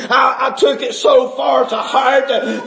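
ordinary delivery

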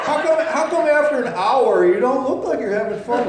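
high, loud teasing question; hammed-up disbelief; mock incredulous challenge. Acted challenge to amused person.

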